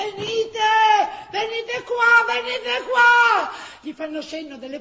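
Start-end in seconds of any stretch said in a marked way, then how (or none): 0.0-3.5 high, loud, slow call across the street; urgent shouted summons. Acted. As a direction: wave them over with your voice. People calling from garage.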